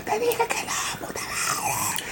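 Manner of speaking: whispered, slow, tearful; broken whisper. Retold or imitated. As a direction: breathe it out, crushed by grief. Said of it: "Y la señora". (susurro quebrado de dolor)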